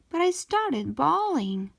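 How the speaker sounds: ordinary delivery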